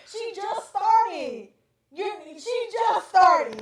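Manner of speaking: each word drawn out, slow and loud; slow, loud, every word punched; slow emphatic insistence. Real, not acted. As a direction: spell it out word by word. Hammering her point in argument.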